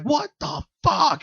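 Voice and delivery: a startled friend's disbelief; shocked. Retold or imitated. Imitating Ashley spooked in the hallway, high shocked cry. Ashley hears the creaks follow.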